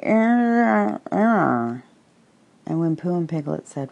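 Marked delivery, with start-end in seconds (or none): 0.0-1.8 loud wordless noise through a mouthful, high then falling; mouth full. A narrator's reading. Tigger, mouth full of acorns, attempts to answer, muffled. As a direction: try to speak round a full mouth. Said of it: "After a long munching noise".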